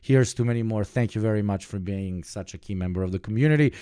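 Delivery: ordinary talk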